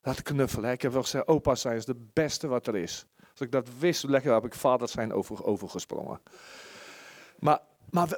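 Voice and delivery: normal talking voice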